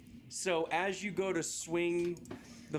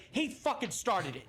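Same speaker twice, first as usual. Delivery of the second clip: lightly acted, higher-pitched indignant gunwoman; shooter's protest; 5 semitones higher than usual. Pistol woman blaming him to the group, mildly acted indignation. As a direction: push back at the group, pitch lifted. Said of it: "and she goes".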